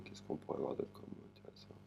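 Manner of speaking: quick whisper to oneself; whispered aside. Real, not acted. Teacher searching for another interesting word, whispered aside. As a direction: think aloud under the breath, whispering fast. Thinking aloud for next word.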